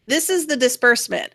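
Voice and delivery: ordinary delivery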